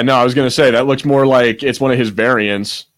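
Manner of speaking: normal talking voice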